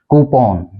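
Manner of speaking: कूपन with open O, koo-pon; exact pronunciation. Shown as a demonstration. Teacher modelling exact pronunciation, कूपन as koo-pon. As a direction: end कूपन on a rounded "pon". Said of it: "ये two syllable word है".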